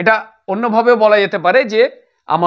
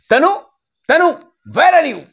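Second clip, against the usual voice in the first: higher-pitched, annoyed calling out; angry call; 7 semitones higher than usual. Acted. Sister looking for Tanu in a small fight, angry call. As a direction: call the name crossly, then demand an answer. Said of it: "She got angry".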